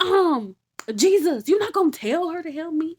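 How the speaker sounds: loud, high indignant whine; indignant complaint. Acted. Martha complaining loudly to Jesus about her sister. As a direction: demand help, loud and put out.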